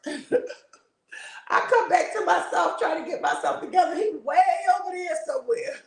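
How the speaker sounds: high, laughing through the words. Real, not acted. Telling about embarrassed husband, laughing high. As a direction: giggle while telling it.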